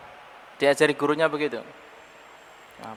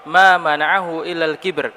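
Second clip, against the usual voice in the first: slow recitation; 3 semitones higher, 6 dB louder, slower than usual. Acted. Slow Arabic quotation.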